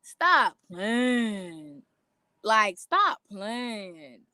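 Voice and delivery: incredulous. Retold or imitated. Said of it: "the more I'm like".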